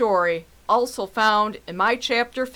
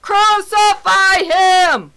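slow, high, loud shout of a mob; drawn-out furious yell; crowd shouting; 8 semitones higher, 7 dB louder, slower than usual. Acted. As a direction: bellow it out like an angry crowd. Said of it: "they shouted all the more".

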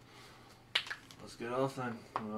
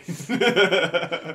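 sudden bursting laugh; loud laugh; 9 semitones higher, 15 dB louder than usual. Real, not acted. Laughing at the Goblin mix-up.

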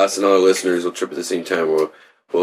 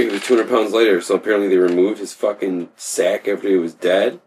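ordinary talk

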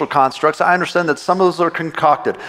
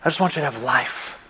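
soft, low, gentle pleading; tender voice of God; 4 semitones lower, 7 dB quieter than usual. Retold or imitated. Pastor voicing God tenderly to the hurting congregation, soft and low. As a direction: murmur it like a loving father. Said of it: "he says".

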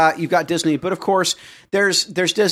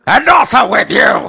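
shouting; 6 semitones higher, 8 dB louder than usual. Acted. Congregation response, mock liturgy.

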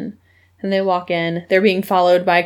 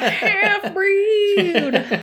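laughing; 11 semitones higher, much slower than usual. Real, not acted. Laughing repeat of word.

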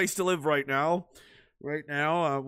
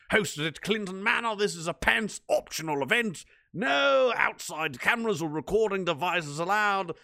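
hammed-up, deliberately stupid British accent, breaking into a shout at the end; silly British accent; 4 semitones higher than usual. Streamer hams up a viewer's invitation in a stupid British accent. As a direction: read it like a ridiculous posh Brit announcing a party. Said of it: "Don't do that accent".

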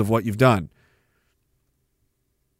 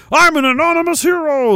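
mocking voice; 13 semitones higher than usual. Mocking an anonymous hero.

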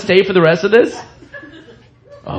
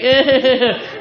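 higher, imitating her mocking laugh; mocking laughter; 7 semitones higher than usual. Comic mimics the heckler's mocking laughter. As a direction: mimic a sneering fake laugh. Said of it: "she's doing the mocking laughter".

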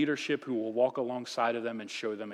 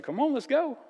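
high, bright come on; acted Jesus inviting Peter out on the water; upbeat invitation; 11 semitones higher than usual. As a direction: wave him out eagerly. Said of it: "he's like".

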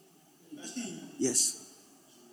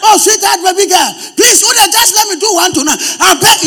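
higher, louder hammed-up begging; mock pleading husband; 6 semitones higher, 8 dB louder than usual. Pastor mimics a husband loudly begging his wife, hammed-up. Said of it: "Is that what they do?".

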